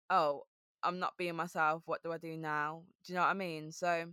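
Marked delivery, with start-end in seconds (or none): 0.1-2.8 low, subdued self-talk; deflated realisation. Acted. Graduate cracks and admits not being herself, deflated. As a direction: sink into a low, dejected admission.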